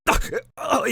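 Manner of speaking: acted high choking splutter; pained choking noise; choking on blood. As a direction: splutter as if mortally wounded. Game master acts character's mortal wound.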